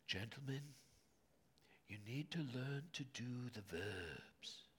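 put-on a light whisper of an Australian professor's accent; Australian brogue. Quoting his Australian professor to the class, light Australian accent. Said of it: "in his kind of brogue".

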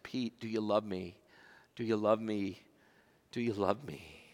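Jesus gently pleading with Peter; tender asking. Acted. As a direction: ask gently, as a friend.

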